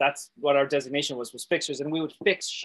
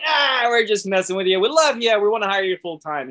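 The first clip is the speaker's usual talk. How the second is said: louder, higher, exuberant burst; gleeful reveal; 5 semitones higher, 5 dB louder than usual. Retold or imitated. Managers revealing the prank to new hire, gleeful. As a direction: let the prank out in a happy outburst. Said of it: "they just started busting out".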